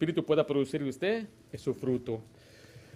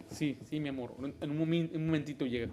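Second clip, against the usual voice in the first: soothing reply; 8 dB quieter than usual. Retold or imitated. Wife soothing angry husband.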